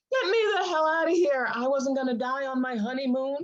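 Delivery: high, fast panicky plea; panicked quote. Retold or imitated. Wife retelling the lion night, in a high panicked squeal. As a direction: squeal it like you're desperate to flee. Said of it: "I was like".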